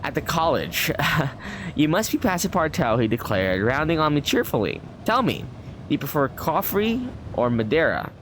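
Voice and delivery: measured storybook reading voice; lightly performed narration; story narration. A narrator's reading. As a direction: read it like an old travel novel. Reads the game's story text.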